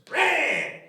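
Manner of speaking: high, loud single shout; yelled. Retold or imitated. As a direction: bark it as he leaps. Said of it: "my dad jumps down and goes".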